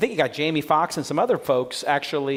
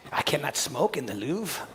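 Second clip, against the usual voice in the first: put-on softer French-accented grumble; a French coder muttering a complaint in French accent; French angry developer; 5 dB quieter than usual. Host lampooning French developer, soft French accent. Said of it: "my French angry developer guy".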